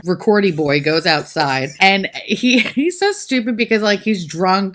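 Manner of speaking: ordinary talk, nothing marked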